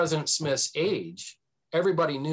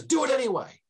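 insistent retold order; 12 semitones higher than usual. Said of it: "And Brown says".

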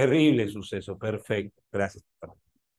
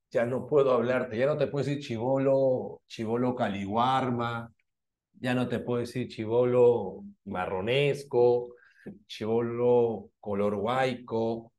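mock lament. Mock-lament teasing a student.

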